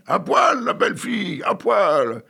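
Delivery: loud, high jeering crowd cry; crowd shouting. Acted. Rowdy music hall crowd yelling at the stage, loud jeering shout. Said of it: "Mais les voilà qui crient".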